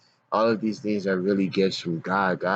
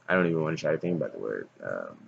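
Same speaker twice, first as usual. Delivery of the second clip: usual speaking voice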